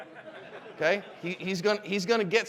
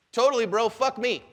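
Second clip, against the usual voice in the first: raised, louder mock-agreeable voice; over-eager agreement; 4 semitones higher, 5 dB louder than usual. Dad leaning in to defuse the driver, over-eager agreement. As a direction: agree heartily with the insult against yourself.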